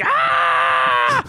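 high-pitched sustained "Ah!" scream. Acted. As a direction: let out a long, high mock scream. Acting out standing and yelling.

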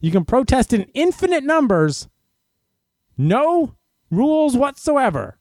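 high, sneering, slowing at the end; mock the double standard; sarcastic. Sarcastic rant about protest rules.

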